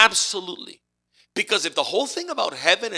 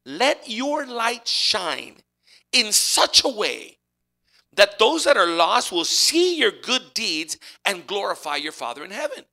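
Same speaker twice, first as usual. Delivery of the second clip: slow, louder preaching of the verse; solemn scripture; 5 semitones higher, 8 dB louder, slower than usual. Acted. Preacher quoting Jesus to congregation, slow solemn proclamation. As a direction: proclaim it slowly like Jesus on the Mount. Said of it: "He says".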